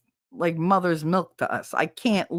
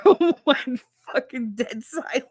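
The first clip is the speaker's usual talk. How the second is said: normal talking voice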